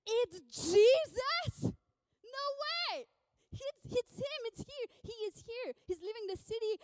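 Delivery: high-pitched, overjoyed amazement; ecstatic disbelief. Acted. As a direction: burst out as if your only chance just walked in. Beggar realizes Jesus is passing by.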